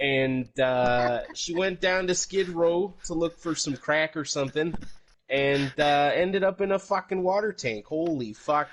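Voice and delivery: over-the-top Canadian bro telling a story; Canadian accent. Pretend. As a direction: tell it like a boisterous Canadian hoser. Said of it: "do this in your Canadian accent".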